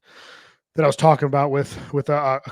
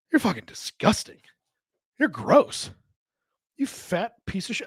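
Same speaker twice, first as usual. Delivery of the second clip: higher, sneering insult at his own reflection; self-disgusted inner voice; 6 semitones higher than usual. Retold or imitated. Man sneering at his reflection in self-disgust. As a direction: spit it at yourself in the mirror. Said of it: "and I went".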